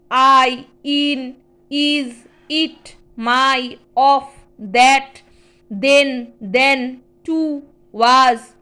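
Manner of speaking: each word read slowly and clearly, one at a time; word drill. Shown as a demonstration. Teacher reading useful words aloud to the class, clear and measured. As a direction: call out each word firmly for the class to repeat.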